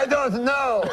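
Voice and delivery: high-pitched stumped French-Canadian uncle; French-Canadian uncle accent. Acted. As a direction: give up in a comic French accent. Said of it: "my uncle goes".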